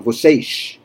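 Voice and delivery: vocês ending in a sh sound, vo-SEYSH; vocês with the Rio sh at the end; Rio accent. Shown as a demonstration. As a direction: Carioca vocês. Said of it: "they do the S at the end".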